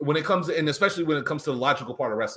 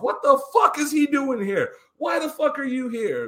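mocking a wrestler's temper tantrum at ringside; imitated tantrum; 12 semitones higher than usual. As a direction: screech it like a grown man throwing a fit. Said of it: "he just starts going off".

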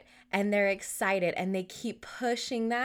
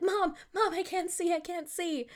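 high-pitched imitation of a panicked kid; yelling for mom; 10 semitones higher than usual. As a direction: play a little boy calling out for his mom. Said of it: "just start yelling for me".

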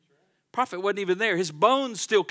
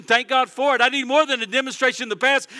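ordinary talk

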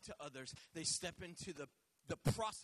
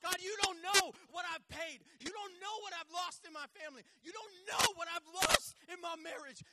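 loud, higher lament to God; anguished complaint; 9 semitones higher, 10 dB louder than usual. Retold or imitated. Preacher voicing a believer shouting losses to God. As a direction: cry out your losses to God.

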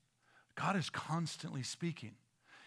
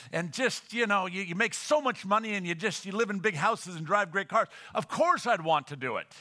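mock gushing, loud and higher; sarcastic preacher rattling off perks; 6 semitones higher, 8 dB louder than usual. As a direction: lay on the sarcasm about church life. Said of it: "that was all sarcasm".

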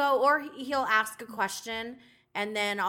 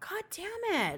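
high, slow, softer mock curse; mock exasperation; 11 semitones higher, 5 dB quieter, slower than usual. Complaining about the farting arbitrators, mock exasperation. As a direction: draw it out high, playfully fed up. Retold exasperated plea continues.

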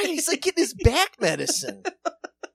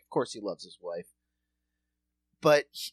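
ordinary talk, nothing marked

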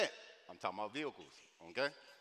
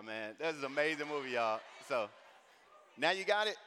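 normal talking voice

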